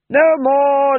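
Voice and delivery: loud, high call to the troupe. A narrator's reading. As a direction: clap them back to work. Actor-manager calls company.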